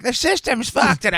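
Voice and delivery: high, loud, slow rant; hammed-up old comic rant. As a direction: grumble it like a worn-out old comic. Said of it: "just being like".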